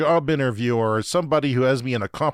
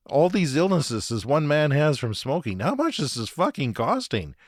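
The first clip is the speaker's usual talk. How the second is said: ordinary delivery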